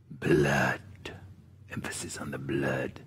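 hushed whisper leaning on blood; low ominous whisper; dramatic whisper. Real, not acted. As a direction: whisper it like a dark secret.

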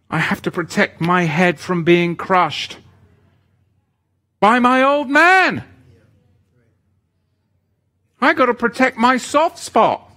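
loud, high revival preaching; fiery preaching. Acted. Preaching at full force.

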